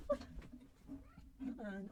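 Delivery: ordinary delivery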